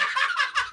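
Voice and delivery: sudden high burst of laughter; bright amused laugh. Real, not acted. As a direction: let a high laugh escape. Amused by Jen's claim.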